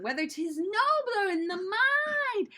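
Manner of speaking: high, slow, swooping recitation; theatrical, drawn-out declaiming; moving while reciting. Shown as a demonstration. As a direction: sweep grandly through the remembered line. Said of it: "I was moving".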